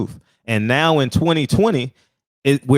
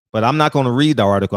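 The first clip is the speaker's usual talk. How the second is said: ordinary talk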